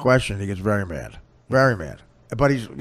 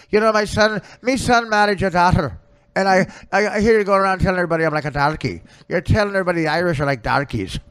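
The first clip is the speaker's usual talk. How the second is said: put-on higher-pitched, indignant old Irishwoman; old Irish woman; 3 semitones higher, 3 dB louder than usual. Old Irishwoman threatens her in-law with a rolling pin, indignant Irish scolding. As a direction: play a scolding Irish mother, loud at the start. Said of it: "I was an old Irish woman".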